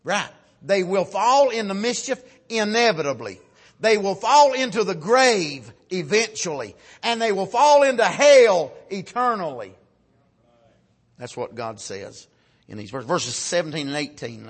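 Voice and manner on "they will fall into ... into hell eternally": loud, measured pulpit proclamation; emphatic preaching. Acted. Preacher warns congregation of the fall into hell, emphatic preaching. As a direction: hammer out each solemn warning.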